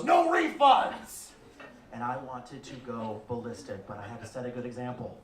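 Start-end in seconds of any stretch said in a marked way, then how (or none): 0.0-1.3 high, very slow, louder jeering no; smug photographer taunting; rude refusal. Retold or imitated. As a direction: drag it out to mock the customer. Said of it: "like an asshole, goes".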